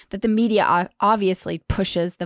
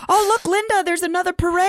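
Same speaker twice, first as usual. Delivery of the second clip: much higher, louder mock-cheery dad; imitating dad; 13 semitones higher, 4 dB louder than usual. Imitating her dad talking to Linda about protests, mock-cheery and higher. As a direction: ham up a clueless dad pointing out a parade. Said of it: "my dad's been calling them parades".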